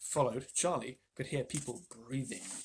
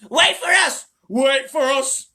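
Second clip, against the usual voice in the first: much higher, louder cries, slow at the end; old folks shouting as they run; 11 semitones higher, 13 dB louder, much slower than usual. A narrator's reading. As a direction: yell after them.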